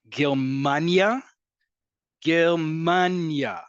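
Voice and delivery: Germania drawn out slowly, leaning on MAN; stress on Germania's second-to-last syllable. Shown as a demonstration. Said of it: "listen to how I'm saying it".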